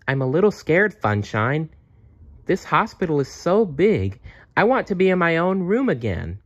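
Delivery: put-on a young girl admitting fear; little girl. Jenny tells Funshine her fears.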